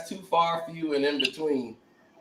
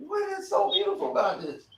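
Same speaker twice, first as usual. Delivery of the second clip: baffled retort; 5 dB quieter, faster than usual. Retold or imitated. Acts out his reply to guests.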